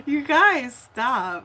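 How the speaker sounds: high, loud laughing plea; laughing protest. Real, not acted. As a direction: beg them to stop through laughter. Chat teasing her.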